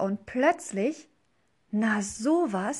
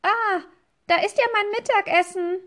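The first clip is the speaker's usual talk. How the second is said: much higher, louder, fast little-bunny squeak; squeaky bunny voice; 11 semitones higher, 5 dB louder, faster than usual. Acted. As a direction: blurt it out high and quick like a tiny hare. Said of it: "sagt meine piepsige Hasenstimme". (piepsige, hohe, schnelle Hasenstimme)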